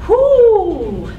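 whoop. Real, not acted. Cheer after the set.

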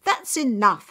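loud, angry shout; shouting father. A narrator's reading. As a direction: cut her off with a yell. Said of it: "her father shouted".

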